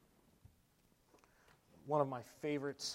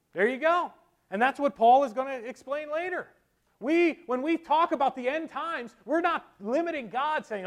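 high, raised pulpit voice; fervent preaching; 12 semitones higher, 10 dB louder than usual. Real, not acted. Preacher teaching congregation about end times, preaching fervently. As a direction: drive the point home energetically.

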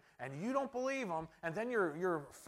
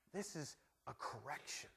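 ordinary talk, nothing marked

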